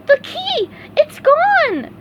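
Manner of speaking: very high, loud panicked yell. A narrator's reading. SpongeBob finding the key gone, high panicked yell. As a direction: cry out in alarm. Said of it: "he yelled".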